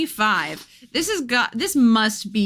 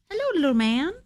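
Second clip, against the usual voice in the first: higher cooing pet voice, rising on hello; 7 semitones higher, faster than usual. Real, not acted. To her cat, a first cooing baby-talk hello.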